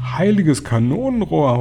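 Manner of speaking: high mock howl of alarm. A narrator's reading. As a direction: wail it out like a panicked cook. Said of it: "heulte der Mann".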